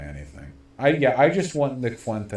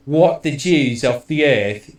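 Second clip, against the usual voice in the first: slow, loud mock British accent; 4 semitones higher, 6 dB louder, slower than usual. Streamer mocking a pundit's read, British accent. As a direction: ham up a pompous British accent, drawn out. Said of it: "he says it in a British way".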